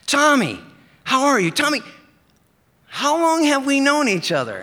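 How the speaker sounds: high, loud, eager friend's voice; Brian's eager voice. Retold or imitated. Brian greets his mail carrier, high and loud. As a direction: pitch it way up and call out to your old buddy.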